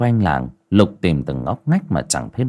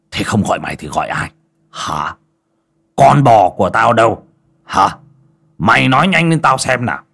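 loud, fast angry interrogation; furious master; 3 semitones higher, 5 dB louder than usual. Acted. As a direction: grill the boy, voice rising to a shout. Master voiced scolding servant.

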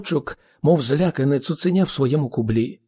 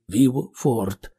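plain reading voice

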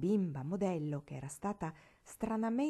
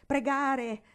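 scornful; 6 semitones higher, 8 dB louder than usual. A narrator's reading. Scornful repetition.

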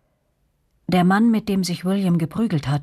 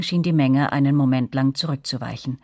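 ordinary talk

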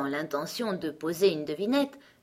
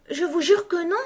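put-on high little-girl voice, earnest; gentle Alice; 12 semitones higher, 6 dB louder than usual. A narrator's reading. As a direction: protest sweetly in a high voice. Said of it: "dit Alice d'une voix douce". (voix douce et aiguë)

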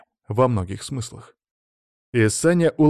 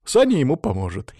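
plain reading voice

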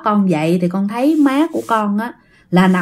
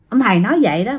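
plain reading voice

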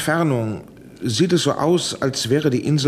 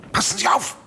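urgent hushed warning; 9 semitones higher than usual. Acted. Warning over the line.

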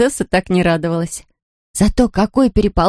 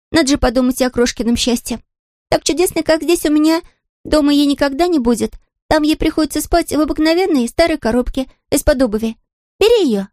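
put-on high child voice; 9 semitones higher than usual. Maya gives up doll.

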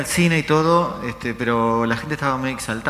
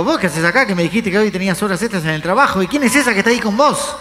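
rising, high-pitched indignant questioning; mock accusation; 7 semitones higher, 4 dB louder than usual. Olga confronting partner about another woman, high indignant accusation. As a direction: imitate a partner demanding explanations.

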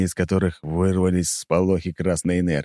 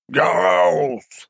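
growled name; 11 semitones higher than usual. Acted. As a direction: bellow the name like a beast. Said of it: "прорычал тот".

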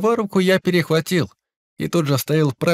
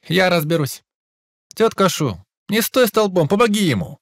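brisk orders, raised voice; commanding; 5 semitones higher than usual. Acted. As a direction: take charge and command. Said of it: "Скомандовал ей я".

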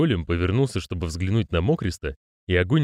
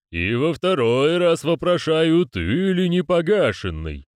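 put-on slow, louder, angry old man; 8 semitones higher, 3 dB louder, slower than usual. A narrator's reading. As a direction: demand it a second time, annoyed. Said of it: "явно рассерженным голосом".

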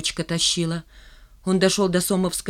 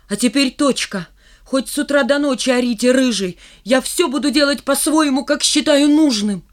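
raised, louder defiant outburst; defiant shout; 6 semitones higher, 6 dB louder than usual. A narrator's reading. Boy defies the whole class, shouting it out. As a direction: voice pitched up, shouting back at everyone. Рыжий finally stands up to class.

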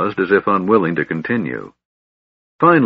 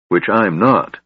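plain reading voice

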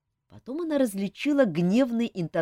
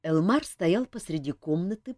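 ordinary delivery

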